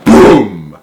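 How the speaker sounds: plain reading voice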